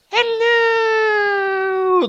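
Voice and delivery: very high, drawn-out hello; falsetto doorman. Acted. Doorman greeting Light's mom. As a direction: croon it.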